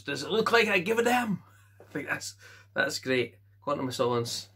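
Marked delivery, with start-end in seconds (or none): 0.0-1.4 quoting Bond. Retold or imitated. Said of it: "He goes".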